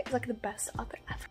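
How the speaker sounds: breathy secret whisper of praise; hushed fangirl aside. Real, not acted. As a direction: lean in and whisper it like a confession. Gushing aside about favorite author.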